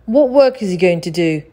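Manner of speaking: loud sulky question. A narrator's reading. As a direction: grumble it like a resentful apprentice. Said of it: "he asked sulkily".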